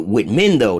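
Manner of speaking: usual speaking voice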